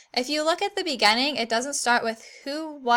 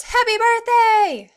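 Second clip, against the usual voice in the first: much higher, louder, slow "Happy birthday!"; teacher's big celebratory exclamation; cheerful exclamation; 11 semitones higher, 9 dB louder, slower than usual. Shown as a demonstration. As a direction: belt it out like greeting a birthday kid. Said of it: "And of course".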